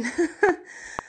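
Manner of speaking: high laugh through tears; choked teary laugh. Real, not acted. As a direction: laugh to hold back the tears. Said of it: "I'm gonna start crying".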